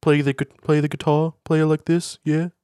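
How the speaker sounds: high put-on Liverpool accent. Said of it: "do the Liverpool accent".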